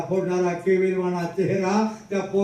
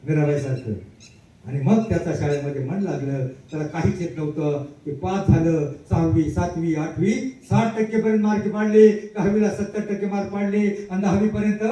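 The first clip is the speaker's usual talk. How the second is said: ordinary talk, nothing marked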